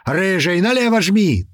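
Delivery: high, urgent shout to the driver. A narrator's reading. Several crew telling the driver to go left, shouting. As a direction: call out, rising in pitch. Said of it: "тут же прокричали ему несколько голосов".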